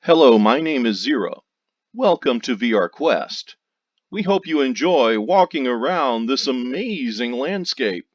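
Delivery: bright, loud welcome announcement; recorded greeting. Shown as a demonstration. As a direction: read it like a theme park greeter. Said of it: "record an audio file directly for her".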